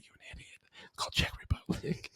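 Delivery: hushed, unvoiced-breath whisper in the ear; whispered correction. Coworker whispering a correction in his ear, whispered. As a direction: whisper it so the caller can't hear. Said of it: "and she whispered".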